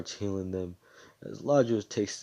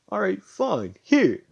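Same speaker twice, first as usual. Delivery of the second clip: mock-casual shout; 11 semitones higher, 7 dB louder, slower than usual. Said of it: "And he says".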